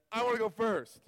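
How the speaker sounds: mock cocky. Retelling his own cocky volunteering to professor, mock-cocky. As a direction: toss it off like a cocky student. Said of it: "not the same guy that went".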